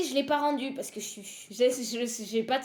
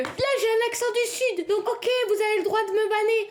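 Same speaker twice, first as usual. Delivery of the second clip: exaggerated southern accent; 8 semitones higher, 3 dB louder than usual. Streamer teasing chat who mocked her accent, in a hammed-up southern accent. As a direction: lay on a mock southern twang. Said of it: "vous jugez l'accent".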